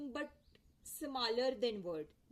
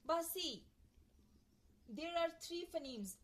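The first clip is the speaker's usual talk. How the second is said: ordinary delivery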